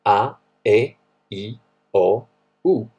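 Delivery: A E I O U said slowly, Italian style, each letter loud and clear; A, E, I, O, U pronounced as in Italian; vowel demo. Shown as a demonstration. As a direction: spell out A E I O U the Italian way. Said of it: "Pay attention".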